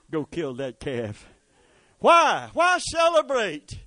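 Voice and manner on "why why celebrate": high loud preacher's call; sudden shouted why; shouted question. Acted. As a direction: fling the question at the crowd.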